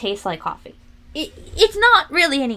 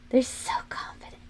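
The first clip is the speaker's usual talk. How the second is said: whispered aside; 7 dB quieter than usual. Acted. Whispered repeat of 77.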